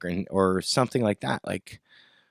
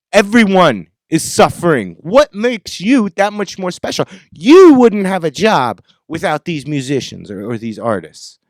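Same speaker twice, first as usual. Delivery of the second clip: indignant, loud, raised pitch; angry rant; 7 semitones higher, 11 dB louder than usual. Real, not acted. Musician telling off greedy promoters, angry rant. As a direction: hit it hard and loud, calling them out.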